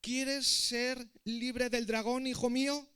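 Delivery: slow, high, loud public question. A narrator's reading. King asking his son before the crowd, loudly. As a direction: project it slowly for everyone to hear. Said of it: "esta vez en voz alta para que todos escucharan".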